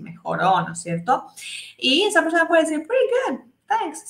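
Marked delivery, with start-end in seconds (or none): 2.9-4.1 high, cheerful answer; recovered friend answering cheerfully; acted reply. Shown as a demonstration. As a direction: reassure the friend you are better. Friend answers after being sick.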